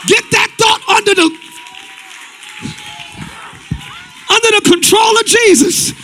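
loud, rising preacher calling to the crowd; full-force preaching. Acted. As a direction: preach it hard and loud to the congregation.

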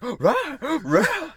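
loud, high gasping dog yelps; goofy cartoon dog barking out words; Scooby-Doo. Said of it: "maybe not Scooby-Doo, 'cause they'd be like".

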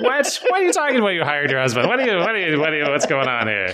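high, laughing disbelief; surprised. Real, not acted. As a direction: blurt out amazed questions. Astonished by hired husband answer.

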